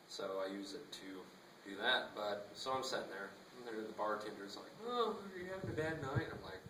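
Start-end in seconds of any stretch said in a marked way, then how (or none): 4.8-6.3 bartender voice. Retold or imitated.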